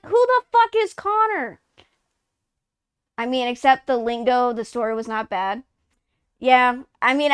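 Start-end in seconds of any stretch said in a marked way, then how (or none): 0.1-1.6 much higher confused outburst; baffled retort. Retold or imitated. Player confused by the game, high disbelieving retort. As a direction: blurt it in disbelief. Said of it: "And I'm like".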